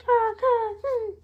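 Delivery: high imitated crow caw; crow noise. Retelling Naruto's failed jutsu, mock crow caw. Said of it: "He made a freaking crow noise".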